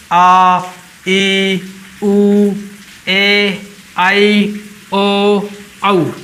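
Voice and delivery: long vowels held, each आ ई उ stretched; vowels drawn out. Shown as a demonstration. Teacher demonstrating long vowels to students, drawn out. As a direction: say आ, ई, उ long and clear. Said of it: "जैसे".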